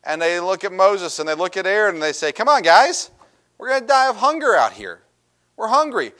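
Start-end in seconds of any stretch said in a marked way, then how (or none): 2.3-6.1 high, loud whiny complaint; whining Israelites. Acted. Preacher mimics the Israelites moaning to Moses in a high whine. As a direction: whine like hungry travellers sure they'll die.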